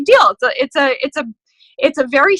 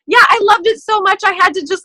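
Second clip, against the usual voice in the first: higher, louder bubbly gushing; excited gushing; 8 semitones higher, 5 dB louder than usual. Retold or imitated. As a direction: gush like a thrilled friend. Acted upbeat recruiting message.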